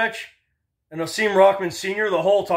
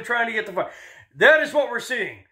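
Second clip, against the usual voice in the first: ordinary talk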